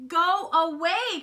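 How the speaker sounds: high, loud, stretched protest; crying out. A narrator's reading. Froggy pestered by Frogalina, high loud cry. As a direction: shoo someone off loudly. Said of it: "cried Froggy".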